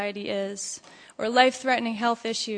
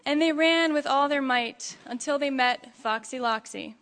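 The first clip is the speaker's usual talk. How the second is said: usual speaking voice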